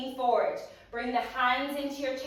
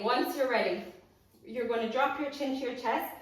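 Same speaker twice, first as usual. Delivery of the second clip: ordinary talk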